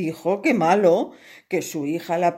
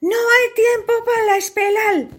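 much higher, louder heroic call; brave hero; 6 dB louder than usual. Acted. Brave painter arrives to rescue the princess, heroic. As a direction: burst in bravely. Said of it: "sonó la voz del valiente".

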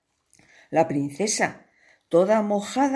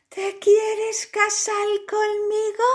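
put-on much higher, slow, girlish princess; slower than usual. Princess asks the painter to marry her, high and sweet. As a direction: play the smitten little princess.

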